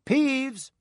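high, called out with a falling tone. Acted. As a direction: call Peeves out sharply. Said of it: "He raised his voice".

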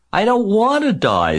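very high, loud tantrum scream. A narrator's reading. Pig throws himself to the ground, screaming. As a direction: shriek it in panic. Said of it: "screamed Wilbur".

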